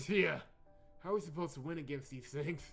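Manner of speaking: exasperated complaint. Real, not acted. Player asks how to beat these enemies, exasperated. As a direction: complain at the game in real exasperation. Complaining about losing the fight.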